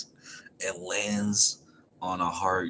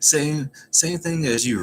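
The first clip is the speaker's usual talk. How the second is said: higher, lightly voiced Usopp; a hint of a puzzled Usopp; Usopp answering; 8 semitones higher, 4 dB louder than usual. Retelling the anime, Usopp answers Nami, lightly higher. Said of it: "And Usopp".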